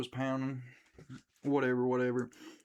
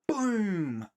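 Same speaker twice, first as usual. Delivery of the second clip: mock gunshot boom; 8 semitones higher, 3 dB louder than usual. Gunshot described again.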